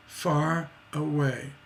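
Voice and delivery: far stretched and stressed, away light. Shown as a demonstration. Teacher showing stress on far in far away. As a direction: lean slowly on far.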